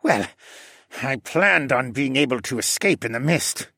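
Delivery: muttering in the assassin's put-on voice; sulky mutter. A narrator's reading. Caged assassin admits his failed escape plan, muttering. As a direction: mutter it as a beaten captive. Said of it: "Dolian muttered".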